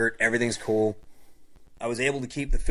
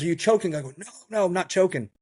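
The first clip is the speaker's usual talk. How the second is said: urgent alarm; 8 semitones higher, 3 dB louder, faster than usual. Retold or imitated. Alarmed exchange about choking.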